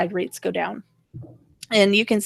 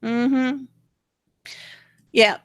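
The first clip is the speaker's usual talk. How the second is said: ordinary talk, nothing marked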